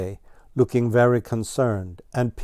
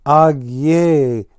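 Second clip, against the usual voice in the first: loud high cry of Ag yea; cried out; 5 semitones higher, 9 dB louder than usual. A narrator's reading. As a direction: wail Ag yea in dismay. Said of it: "cried Ground Squirrel".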